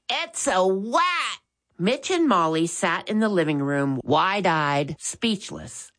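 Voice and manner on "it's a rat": "wat" said with a w for the r, slowly; a small child saying "It's a wat!"; child's "wat" for rat, slow and higher; little child's lisp. A narrator's reading. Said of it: "said loudly".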